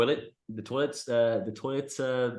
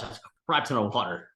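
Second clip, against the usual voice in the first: ordinary delivery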